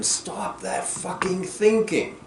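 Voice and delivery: retold patient yelling at the analyst to stop thinking; imitated yell. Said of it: "the patient yells at him".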